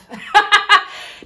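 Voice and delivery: high, loud triumphant laugh; cracking up at the friend walking out; real laugh. Real, not acted. Laughing that the friend left, high and loud.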